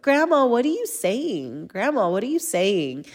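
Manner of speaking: put-on squeaky three-year-old voice; squeaky little-kid voice. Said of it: "I just hear his squeaky little voice".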